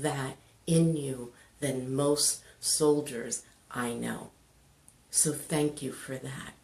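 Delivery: soft, measured, sincere tribute; heartfelt commendation. Retold or imitated. Officer commending the little boy's honor, quiet heartfelt sincerity.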